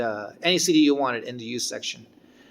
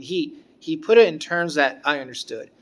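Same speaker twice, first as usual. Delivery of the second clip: usual speaking voice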